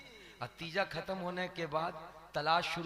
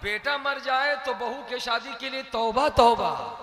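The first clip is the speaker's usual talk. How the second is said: louder, higher mock tauba; loud mock outrage; 5 semitones higher, 10 dB louder than usual. Preacher mimicking village women's outrage, loud mock tauba.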